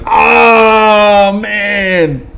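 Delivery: long, high, drawn-out cry; mock pained wail. Retelling being hit in the lip, high drawn-out wail.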